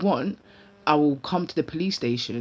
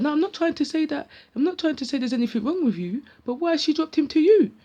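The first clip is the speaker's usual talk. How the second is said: defensive protest; 10 semitones higher, 3 dB louder than usual. Retold or imitated. Brother protesting on the phone.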